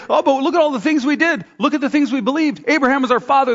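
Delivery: high, insistent list of their merits; indignant pleading. Acted. Leaders pleading their case to God.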